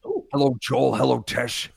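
lightly voiced wrestler greeting; a hint of a gruff wrestler; Jesse Ventura. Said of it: "Jesse Ventura is here".

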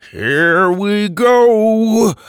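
high, loud, drawn-out; slow excited cry. Acted. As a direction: brace for impact and yell it. Wheelchair landing charge.

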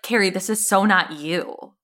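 normal talking voice

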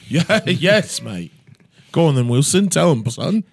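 hammed-up pub Brit, a hint of cockney; playing the cheeky Englishman for laughs; mock British accent. Mock Brits after lost revolution.

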